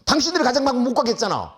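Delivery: principal's mock angry comeback; angry retort. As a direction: throw it back in annoyance. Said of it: "나한테 화를 낸 거예요".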